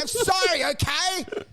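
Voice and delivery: high-pitched, whining apologies; hammed-up wounded self-justifying; acted pleading. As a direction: plead pitifully with an attacker. Beaten man keeps begging old man.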